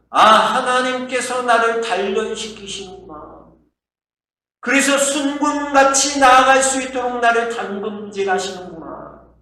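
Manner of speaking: high, loud cry of recognition; Job's realization. Acted. Job realizing God is refining him, fervent exclamation. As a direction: exclaim it fervently as a revelation dawns. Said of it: "어떻게 해석되어졌나".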